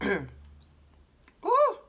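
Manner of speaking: high ooh at the spice. Real, not acted. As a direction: gasp at the heat. Heat from spices.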